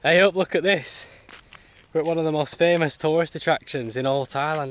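normal talking voice

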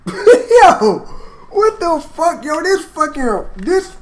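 high, loud laughing outburst; laughing hype. Real, not acted. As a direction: laugh it out, blown away.

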